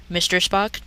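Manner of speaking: ordinary delivery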